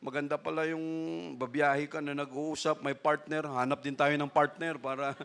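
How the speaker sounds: a quiet, half-joking quote of a fellow brother; quoting Brother Sam. Retold or imitated. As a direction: pass on a friend's joke under your breath. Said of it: "sabi ni Brother Sam".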